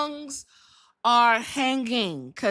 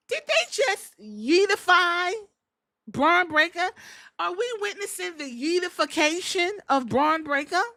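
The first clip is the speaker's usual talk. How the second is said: amazed disbelief; 8 semitones higher than usual. Real, not acted. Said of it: "and I said".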